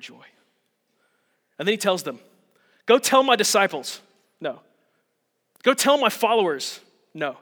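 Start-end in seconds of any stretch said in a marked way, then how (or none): 2.8-4.0 higher, louder hypothetical order; mock stern command. Jesus supposedly ordering the women, mock stern. 5.6-6.8 higher, louder hypothetical order; mock stern command. Jesus supposedly ordering the women, mock stern. Second imagined command, rejected.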